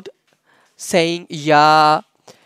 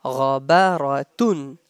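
Ghabaratun said as one connected word; joined up; 4 semitones lower than usual. Shown as a demonstration. Teacher showing students the word without spellings, joined up. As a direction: run the syllables of Ghabaratun together without breaks. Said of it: "without spellings".

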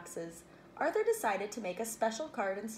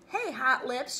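mildly raised, higher teasing call; storybook taunt, a bit louder; teasing shout; 4 semitones higher, 6 dB louder, slower than usual. A narrator's reading. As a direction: call it out to tease him. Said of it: "shouted Francine".

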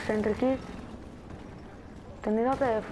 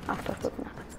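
softer, voiceless muttering; whispered aside; 7 dB quieter than usual. Real, not acted. Gamer whispering to himself while placing the sentry. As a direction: say it under your breath.

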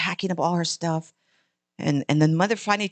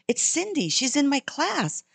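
put-on little girl voice; 6 semitones higher than usual. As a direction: answer like a proud little girl. Girl answers her mother.

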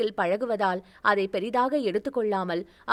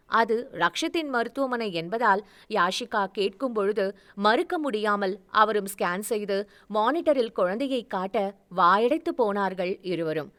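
usual speaking voice

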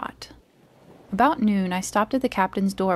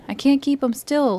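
higher-pitched pleading tone; 6 semitones higher, 4 dB louder than usual. A narrator's reading. Sick captain showing Jim his trembling fingers, pleading. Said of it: "he continued in the pleading tone".

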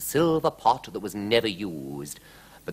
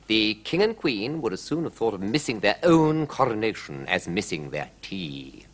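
ordinary delivery

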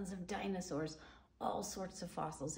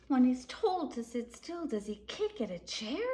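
louder, animated picture-book reading; reading to children; 6 semitones higher, 5 dB louder than usual. A narrator's reading. Reading a dinosaur book to children, animated and louder. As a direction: boom out the parents' no like a storyteller.

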